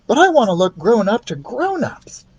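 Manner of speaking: put-on high insistent boy; boy pleading his point; boy's voice. Keith voiced.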